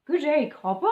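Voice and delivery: ordinary delivery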